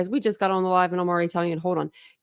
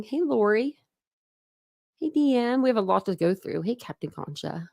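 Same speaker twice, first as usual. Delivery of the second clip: ordinary talk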